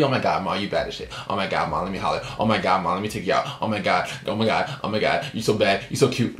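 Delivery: low, lightly put-on thirsty-guy voice; mock flirting.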